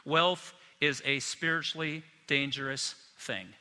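slow, deliberate words for note takers; slow dictation for the note takers. Shown as a demonstration. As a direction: say it slowly so they can write it down. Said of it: "I'd like you to write this down".

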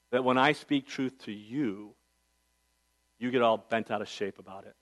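a hint of a loving wife speaking softly; sweet, kind, loving. Preacher quotes his wife's gentle correction, a hint of sweetness. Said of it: "in a sweet, kind, loving spirit".

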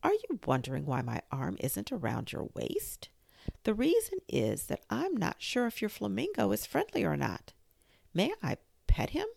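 a hint of a preachy Duchess. A narrator's reading. Duchess to Alice.

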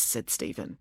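ordinary delivery, nothing marked

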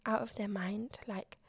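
whispered inner doubt, fading off; doubtful whisper. Retold or imitated. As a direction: hush it like a private worry. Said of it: "a piece of self-doubt that I'm like".

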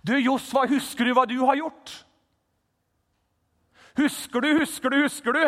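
high, loud taunting accuser pressing Josva; accuser's taunt. Acted. Preacher voicing Satan taunting Josva, loud and high. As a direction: jeer at him, make him remember.

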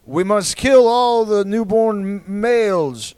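slow, stately proclamation as a hammed-up king; mock king decree. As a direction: boom it out like a pompous king, slowly. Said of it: "and the king would be like".

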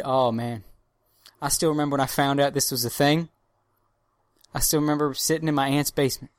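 ordinary delivery

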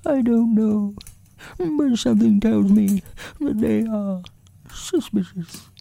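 very high, small voice for a pondering bear; high-pitched bear explaining earnestly; Winnie the Pooh. A narrator's reading. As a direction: speak as a thoughtful little toy bear, high and thin.

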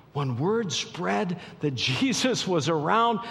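impassioned preacher gathering height and force; building, full-force preaching. Real, not acted. Preacher telling of the sick brought to Jesus, building to full force.

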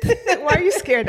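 high, slow, laughing tease; laughing question. Real, not acted. Host teasing a nervous guest, laughing.

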